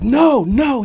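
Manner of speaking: urgent no. Retold or imitated. Wood stopping his friend.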